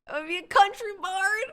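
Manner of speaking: high, loud country twang; hammed-up country bard; country accent. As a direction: belt it out in a put-on country accent. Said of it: "Country accent?".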